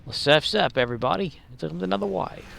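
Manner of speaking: put-on a light, hammy Australian accent; mock Australian surfer call. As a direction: play a cheerful Aussie surfer, loosely Australian. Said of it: "trying to do an Australian accent like that".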